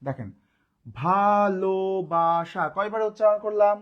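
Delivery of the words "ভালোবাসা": ভালোবাসা very slowly as "bha - lo - ba - sha"; syllable by syllable. Shown as a demonstration. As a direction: stretch each of the four syllables of ভালোবাসা. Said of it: "ধীরে ধীরে উচ্চারণ করেন". (ভালোবাসা চার ভাগে, টেনে টেনে)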